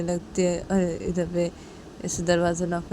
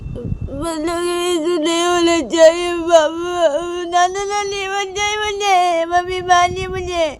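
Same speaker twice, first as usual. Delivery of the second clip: put-on much higher, slow whiny little girl; crying child; 12 semitones higher, 5 dB louder, slower than usual. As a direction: plead like a weeping little girl. (रोती बच्ची की गुहार)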